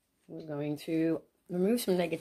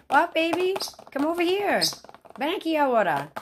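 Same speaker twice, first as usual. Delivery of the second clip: very high, loud pet call; 12 semitones higher, 8 dB louder than usual. Real, not acted. Calls the fussing bird, switching to Spanish.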